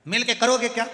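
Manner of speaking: exasperated grandson half-shouting at the deaf grandfather; retold yell. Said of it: "नाना चिल्ला कर बोला".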